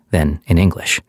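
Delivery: ordinary delivery, nothing marked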